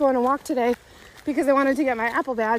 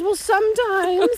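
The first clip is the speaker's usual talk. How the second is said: put-on kid voice; 7 semitones higher, 4 dB louder, slower than usual. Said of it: "and then she goes".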